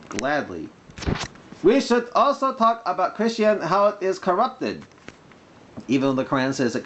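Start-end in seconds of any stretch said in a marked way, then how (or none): 1.6-4.8 mock Arabic accent. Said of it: "when I'm doing the mock Arabic accent".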